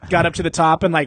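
ordinary talk, nothing marked

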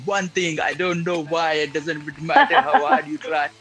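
singing in a mock Indian accent. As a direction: rap it in an exaggerated Indian accent. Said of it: "in his Indian accent".

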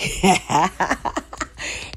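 soft airy laugh; quick laugh under breath; breathy laugh. Real, not acted. Laughing at own voice.

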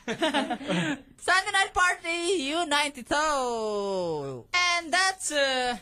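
stretched-out radio ID with rising hype; station ID. Shown as a demonstration. Radio host records a sample for the band, hyped drawn-out announcer. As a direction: draw out each phrase like a party announcer.

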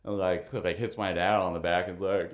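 ordinary talk, nothing marked

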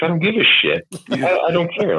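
hammed-up frustrated outburst; mock exasperated yell. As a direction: explode like you've hit your limit. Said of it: "I'm like".

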